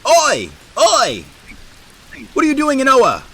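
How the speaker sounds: high, loud shouted challenge; hammed-up guard yelling from the bridge. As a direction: bellow a warning as a city guard. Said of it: "one of the guards yells out to you".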